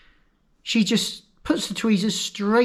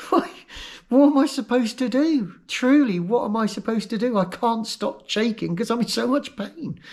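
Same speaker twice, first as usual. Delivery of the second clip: higher-pitched helpless pleading; retold desperate protest; 6 semitones higher than usual. Patient in pain answers the nurse, helplessly protesting.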